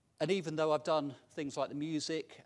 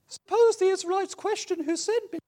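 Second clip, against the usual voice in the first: much higher, louder anxious voice; whiny Moses; 14 dB louder than usual. Acted. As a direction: fret as a nervous Moses. Acting Moses in dialogue.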